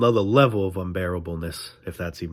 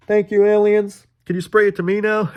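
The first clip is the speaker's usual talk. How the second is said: high loud begging to the aliens; pleading worker; 10 semitones higher, 6 dB louder than usual. Pretend. Worker begging aliens for the sleep mist, high loud plea.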